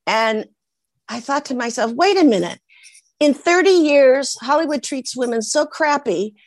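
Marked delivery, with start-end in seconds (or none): 1.9-6.3 higher, emphatic self pep talk; mounting reenacted determination; defiant resolve. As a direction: declare it like a personal vow. Acts out defiant inner pep talk.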